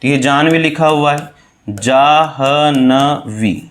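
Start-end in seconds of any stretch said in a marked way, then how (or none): 1.7-3.6 drawn out very slowly, one syllable at a time. Shown as a demonstration. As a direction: stretch Jahnavi into separate, spelled-out syllables. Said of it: "इस तरह से".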